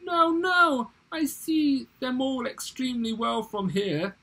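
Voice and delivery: very high, loud mock wail; theatrical high-pitched pleading; despairing cry. A narrator's reading. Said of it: "weeping in despair, he cried".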